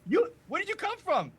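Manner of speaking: put-on high, loud excited child voice; little kid voice. Little kid amazed at strange visitors, high and loud. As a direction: squeak it out like an amazed little boy. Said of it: "kinda whispering".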